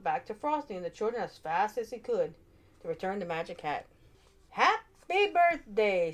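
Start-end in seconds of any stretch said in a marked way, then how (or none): 4.5-6.1 very slow, drawn-out snowman voice; slow dazed snowman. A narrator's reading. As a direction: stretch it out like a waking snowman. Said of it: "said Frosty again".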